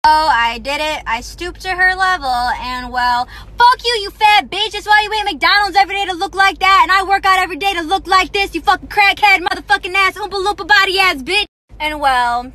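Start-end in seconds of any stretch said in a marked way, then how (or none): 0.0-11.5 loud, high furious yelling; furious rant. Real, not acted. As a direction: tear into her at the top of your voice.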